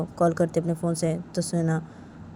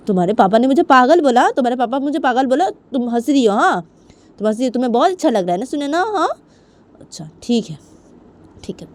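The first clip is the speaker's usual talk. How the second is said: raised, high aggrieved voice; angry, threatening mother; 5 semitones higher, 8 dB louder than usual. Acted. (गुस्से में धमकी देती माँ)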